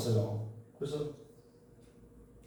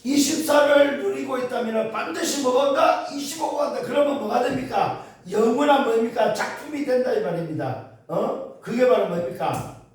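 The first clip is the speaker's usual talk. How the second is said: high, loud, emphatic sermon delivery; impassioned preacher, pitched high and loud; full-force preaching; 8 semitones higher, 5 dB louder than usual. Real, not acted. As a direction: drive each point home to the congregation.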